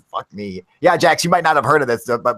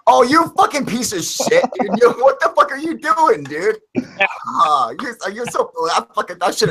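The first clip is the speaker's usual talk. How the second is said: mock outrage; 9 semitones higher, slower than usual. Catches friend smoking after quitting.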